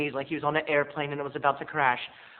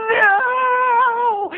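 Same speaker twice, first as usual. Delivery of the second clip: very high loud scream; shrieked no; 10 dB louder than usual. Retold or imitated. Clerk refusing the manager, very high shriek.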